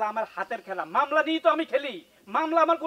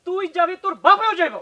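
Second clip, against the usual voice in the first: louder and higher, menacing; angry threat; 6 semitones higher, 8 dB louder than usual. Acted. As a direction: threaten her loudly. Husband threatening wife in quarrel. (রাগে হুমকি দিয়ে চেঁচিয়ে বলা)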